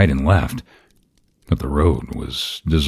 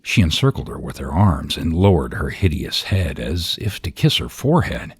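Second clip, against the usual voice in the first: ordinary delivery, nothing marked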